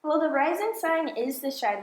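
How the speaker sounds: ordinary talk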